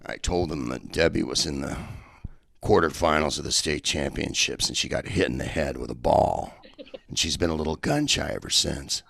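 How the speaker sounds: low, dry deadpan retelling; deadpan explanation. Retold or imitated. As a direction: lay the lie out with a straight face, low. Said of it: "And he goes".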